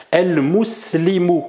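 «El muslimu» drawn out slowly, final u clearly sounded; slow Arabic demo. Shown as a demonstration. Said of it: "Pourquoi ici, il y a une damma". («muslimu» prononcé lentement, le u final bien marqué)

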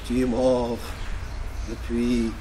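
low, hushed poetic murmur with long pauses; soft murmur. A narrator's reading. Rebel girl murmuring to the poet that he is dead, soft murmur. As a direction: murmur it low, letting the words fade. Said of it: "et m'a murmuré".

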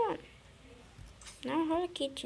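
ordinary delivery

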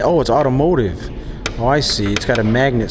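usual speaking voice

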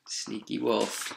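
soft, slow sneaky aside; quiet slow aside. Real, not acted. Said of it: "aside to listeners".

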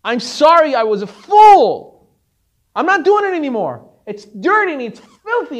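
loud, tearful confession; tearful outburst. Retold or imitated. Disciple loudly confessing to his rebbe, tearful. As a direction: cry it out to the rebbe, swearing off money. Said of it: "he starts to cry and he says".

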